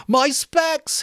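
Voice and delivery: high, loud howl of panic. Acted. Piggy howling for his glasses, high desperate howl. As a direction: cry out for the lost glasses. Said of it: "howled Piggy".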